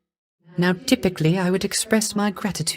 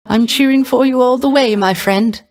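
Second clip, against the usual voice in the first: louder, rousing encouragement; cheering; 4 semitones higher, 4 dB louder than usual. Acted. Bard cheering on his friend Zagreus, rousing and loud. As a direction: cheer him on with real gusto.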